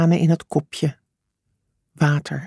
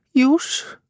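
high rising call of a name; restrained high-pitched cry; 10 semitones higher than usual. A narrator's reading. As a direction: call anxiously to a child, not shouting. Parent calls to buried child.

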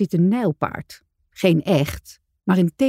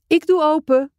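higher, louder call toward the door; 10 semitones higher, 5 dB louder than usual. A narrator's reading. Dolfje calling to the family that he'll get the door, loud. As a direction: shout it across the room. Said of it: "roept Dolfje".

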